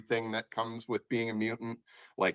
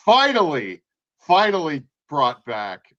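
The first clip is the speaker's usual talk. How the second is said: louder and higher on finally; excited; 5 semitones higher, 9 dB louder, slower than usual. Real, not acted. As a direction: burst out with finally. Excited about post-credit scene.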